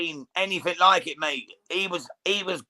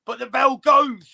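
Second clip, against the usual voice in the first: excited commentary, higher and rising at the bell; live play-by-play; 4 semitones higher than usual. Real, not acted. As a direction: call the last seconds of the round live. Live round-end commentary.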